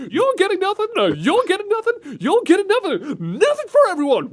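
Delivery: high, loud sassy lady voice; sassy Oprah Krillin. Retold or imitated. Host imitating the voice as a sassy talk show lady. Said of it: "Krillin mixed up with Oprah".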